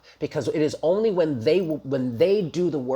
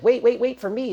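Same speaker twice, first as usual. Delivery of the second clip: higher, urgent mock plea; mock pleading follower; 10 semitones higher, 4 dB louder than usual. Imagined follower begging the listener to wait, mock pleading. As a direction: call out as if chasing someone. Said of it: "running after you, like, hot on your heels".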